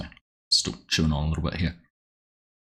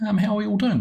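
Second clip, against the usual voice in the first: much higher, bright sing-song hello; cheery greeting; faster than usual. Real, not acted. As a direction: greet the chat with a lifted voice. Streamer greeting chat viewers.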